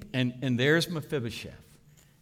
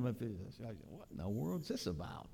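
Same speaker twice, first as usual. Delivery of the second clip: bewildered; 3 semitones lower, 10 dB quieter than usual. Acted. Mephibosheth bewildered by welcome.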